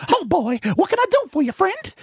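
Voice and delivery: high cartoon mouse voice; Mickey Mouse voice. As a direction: squeak it like Mickey. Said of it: "Mickey Mouse".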